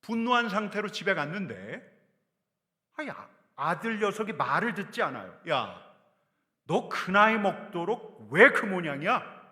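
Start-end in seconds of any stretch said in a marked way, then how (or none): 5.5-9.4 loud, slow scolding yell at his son. Acted. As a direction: bellow at the boy. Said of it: "제가 소리를 버럭 지를 수가". (아들에게 버럭 소리치듯)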